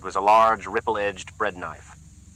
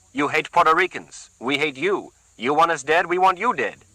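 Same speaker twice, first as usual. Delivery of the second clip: put-on higher, louder threat with a light Puerto Rican accent; 7 semitones higher, 3 dB louder than usual. A narrator's reading. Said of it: "He spoke with a less pronounced accent".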